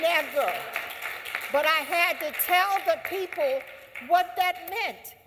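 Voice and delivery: rising oratory fervor; impassioned declaration. Real, not acted. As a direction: proclaim it like a rally speech. Fired-up speaker stressing conviction.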